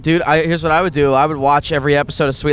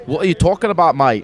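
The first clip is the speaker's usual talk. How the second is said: accidentally Australian; 3 semitones higher than usual. Pretend. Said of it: "That was a little bit Australian".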